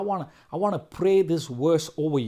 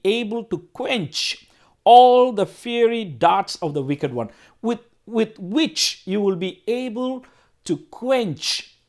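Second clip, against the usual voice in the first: slow, raised preaching delivery with drawn-out words; emphatic scripture reading; 5 semitones higher, 5 dB louder, slower than usual. Acted. Preacher highlighting a verse on the shield of faith, emphatic. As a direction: declaim the verse slowly, hitting key words hard.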